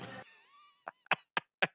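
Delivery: high squealing laugh; shrieking laugh. Real, not acted. Big laugh.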